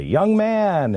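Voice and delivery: high, drawn-out jovial call; hearty greeting. Retold or imitated. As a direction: greet him like a favourite protégé, stretching it out. Usual hearty greeting, retold.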